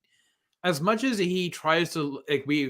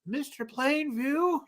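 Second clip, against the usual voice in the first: higher, slow, softer calls; timid butler calling; 9 semitones higher, 5 dB quieter, slower than usual. Retold or imitated. Poor butler calling Plainview after the murder, slow and timid. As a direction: call him hesitantly from the doorway. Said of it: "the poor butler".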